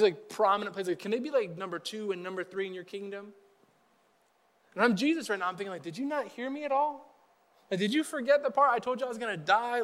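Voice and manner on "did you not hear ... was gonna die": higher-pitched disbelieving questions; mock incredulous. Pastor as Jesus to forgetful followers, mildly incredulous. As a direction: voice mild exasperated disbelief.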